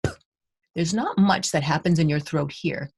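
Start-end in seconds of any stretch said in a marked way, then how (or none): 0.1-0.1 P popped as a short unvoiced stop; p sound. Shown as a demonstration. Teacher demonstrating P to class, popped puff. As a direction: pop P with a puff of air. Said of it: "feel that again".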